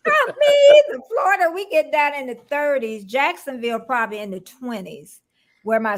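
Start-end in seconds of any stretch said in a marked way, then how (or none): 0.1-0.8 loud high laughing cry of "not me"; laughing outburst. Real, not acted. Host laughing loudly at her guest. Laughing burst at cold talk.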